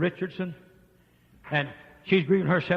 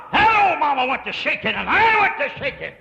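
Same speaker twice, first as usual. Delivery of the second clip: preaching at full force; 11 semitones higher, 13 dB louder than usual. Real, not acted. Preacher rising to a shouted climax over the mother. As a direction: drive it home to the congregation.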